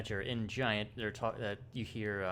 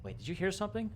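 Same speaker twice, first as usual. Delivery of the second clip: sudden high startled question; guard hears something, high and alert; alarmed guard; 11 semitones higher than usual. Acted. GM voices alarmed giant guard.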